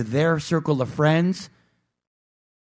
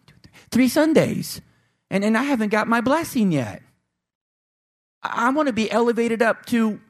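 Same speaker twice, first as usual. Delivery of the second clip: whiny, raised pitch, impatient for a blessing; impatient churchgoer; 6 semitones higher than usual. Pretend. Churchgoer nagging the pastor for a quick blessing, whiny high voice. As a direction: plead with the pastor like a spoiled child. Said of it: "impatient churchgoer voiced".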